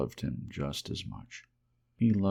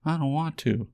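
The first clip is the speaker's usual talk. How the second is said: high, louder refusal; reluctant little rabbit; 9 semitones higher, 5 dB louder than usual. A narrator's reading. Little rabbit refuses the wild rabbit, high and reluctant. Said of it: "he said again".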